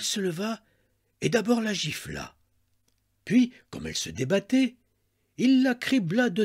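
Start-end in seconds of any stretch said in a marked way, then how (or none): none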